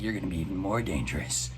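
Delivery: low, soft, hurried creepy-man voice. Retold or imitated. Recapper quoting the creepy man's line, lightly voiced creepy man. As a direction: lightly imitate a creep, dropping low and quiet. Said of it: "the creepy man is like".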